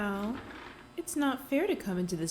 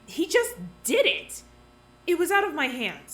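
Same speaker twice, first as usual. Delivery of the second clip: defensive outburst; 6 semitones higher, 5 dB louder than usual. Acted. Accused of the killing, a loud defensive protest. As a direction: throw up your hands, it wasn't me.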